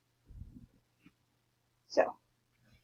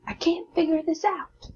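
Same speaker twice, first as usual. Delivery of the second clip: exclaimed example; 7 semitones higher, 4 dB louder than usual. Shown as a demonstration.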